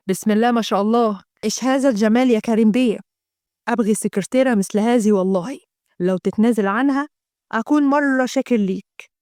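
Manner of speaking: a lightly put-on Gulf Arabic accent, admiring. A Gulf client admires the secretary, Gulf Arabic accent. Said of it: "الناس الخلايجه".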